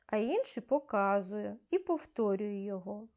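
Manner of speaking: ordinary talk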